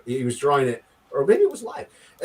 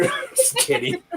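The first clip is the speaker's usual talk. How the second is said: laughing; 5 dB quieter than usual. Real, not acted. Teasing a friend, then laughing.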